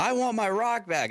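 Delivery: put-on high, pleading kid voice; whiny child. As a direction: mimic the little girl begging for her rock. Imitating the daughter.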